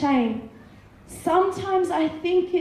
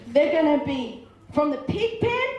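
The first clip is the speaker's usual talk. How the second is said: ordinary talk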